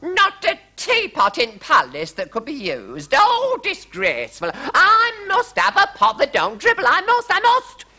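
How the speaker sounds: very high, loud desperate queen; rising panic, demanding her pot; frantic queen. A narrator's reading. Queen insisting on a teapot, very high and frantic. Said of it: "cried the Queen".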